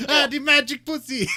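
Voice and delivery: put-on loud, high, laughing Italian accent; hammed-up Italian accent. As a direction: belt it out like a cartoon Italian. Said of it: "that's more Italian".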